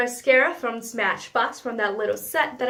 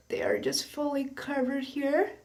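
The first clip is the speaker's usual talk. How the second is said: whispered while applying mascara; half-whisper, busy with mascara; 6 dB quieter than usual. Real, not acted. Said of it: "I can't talk and do my mascara at the same time".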